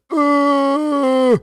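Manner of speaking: high, loud sustained uh; long held uh. Real, not acted. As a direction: hold a high note on the filler. Held filler turned into a tone.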